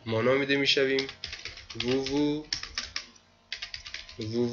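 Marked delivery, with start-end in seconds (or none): none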